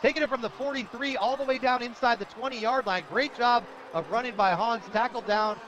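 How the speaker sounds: high, loud football call; excited play-by-play. Real, not acted. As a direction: call a breakaway run live. Calls the play live.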